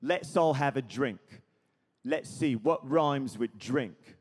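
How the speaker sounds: British rock-star drawl in short bursts; exaggerated rock-star musing, slowing at the end. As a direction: think aloud like a swaggering rock star. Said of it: "and he goes".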